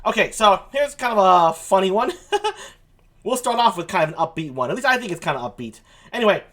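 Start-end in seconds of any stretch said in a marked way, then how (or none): 2.2-2.7 short high chuckle; brief, light giggle at a higher pitch. Real, not acted. Host amused by own funny news item, short chuckle.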